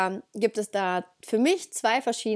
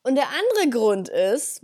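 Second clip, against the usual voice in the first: ordinary delivery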